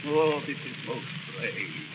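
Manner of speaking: quiet sneer at his own ranting; bitter self-mockery. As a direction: drop low and mock yourself. Bitter self-mockery after an outburst.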